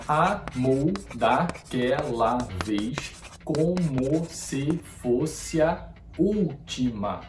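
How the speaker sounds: very slow, each poetic syllable set apart; slowly sounding out the verse's poetic syllables; slow syllable split. Shown as a demonstration. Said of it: "Um exemplo".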